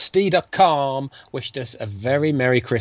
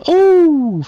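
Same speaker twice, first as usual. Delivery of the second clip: delighted oh; 8 dB louder than usual. Real, not acted. Thanked on air, delighted.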